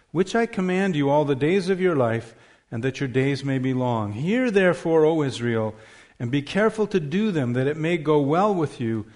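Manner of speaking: solemn public reading of scripture. Acted. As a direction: proclaim the passage to the congregation. Reads Deuteronomy six aloud.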